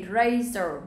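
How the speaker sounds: "razor" with a long AY; long a vowel. Shown as a demonstration. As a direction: model "razor" as "RAY-zor". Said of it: "đọc cho cô là âm a". (đọc "razor" với âm ây)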